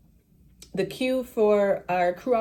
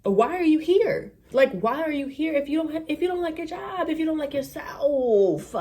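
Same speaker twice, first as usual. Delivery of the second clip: high, incredulous scolding of a rude agent; exasperated rant; 8 semitones higher than usual. Retold or imitated. Passenger scolding a rude gate agent, exasperated high rant. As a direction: lay into him with disbelief.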